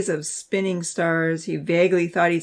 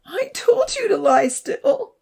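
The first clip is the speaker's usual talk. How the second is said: high, louder, slow sobbing reproach; 6 dB louder, slower than usual. A narrator's reading. Abby sobs at Zach after he vomits, high and tearful. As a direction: scold through tears. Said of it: "she said, sobbing".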